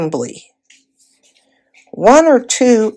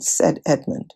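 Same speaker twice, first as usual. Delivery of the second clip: ordinary delivery, nothing marked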